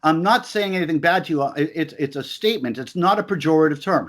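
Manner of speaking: normal talking voice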